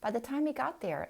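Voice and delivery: plain reading voice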